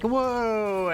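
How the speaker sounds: high, drawn-out mock wow; hammed-up awe, sliding down from high; mock amazement. Acts out team's amazed reaction.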